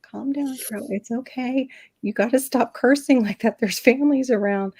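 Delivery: imitating an anxious attempt to calm someone; ex-boyfriend calming her down. Boyfriend tells panicking girlfriend to settle, mildly acted urging.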